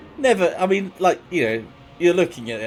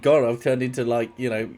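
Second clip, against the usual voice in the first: normal talking voice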